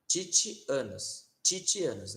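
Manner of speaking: titianos with a chi sound on each t; slowly titianos, tchi-tchi-a-nos; restored Latin. Shown as a demonstration. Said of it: "Não vai ser titianos nem ticianos".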